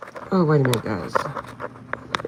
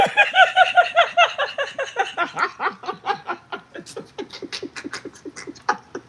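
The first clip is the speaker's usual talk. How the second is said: extended squealing laugh; long high laughing fit. Real, not acted. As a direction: let the laugh run on.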